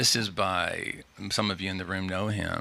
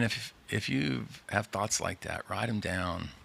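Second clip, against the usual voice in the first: usual speaking voice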